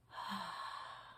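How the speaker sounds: long soft relaxed sigh; deep sigh. Shown as a demonstration. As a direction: breathe out slowly. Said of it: "Sigh a little bit".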